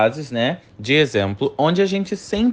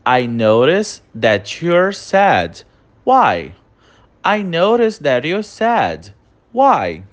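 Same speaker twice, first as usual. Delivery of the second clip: slow dictation; much slower than usual. Shown as a demonstration. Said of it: "Olha a nossa próxima frase".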